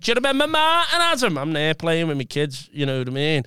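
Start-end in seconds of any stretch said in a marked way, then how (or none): 0.0-1.4 high-pitched imitation of mum; mum's voice. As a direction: put mum's question up high. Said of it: "She went".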